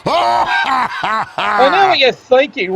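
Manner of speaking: long high cackle into slow taunting words; cackle, then drawl the taunt; drawn-out villainous laugh; evil laugh. Acted.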